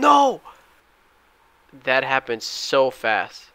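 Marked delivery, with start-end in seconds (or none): 0.0-0.4 sharp, much higher shout of NO; sudden shout. Real, not acted. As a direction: yell in real frustration as the price flips. Watching the stock spike then fail.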